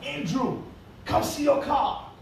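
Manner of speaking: calling his son outside, pitched high. Retold or imitated. Father calling his son to come see the new car, high and eager. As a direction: call him out to the car, bright and eager.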